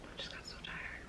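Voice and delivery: breathy tired whisper under breath. Real, not acted. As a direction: whisper it to yourself, worn out.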